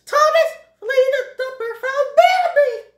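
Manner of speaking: high, loud announcement yell; excited shriek. Acted. Thumper's sister announces Bambi is found, excited shriek. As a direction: shriek the good news at top pitch. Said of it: "one of Thumper's sisters shrieks".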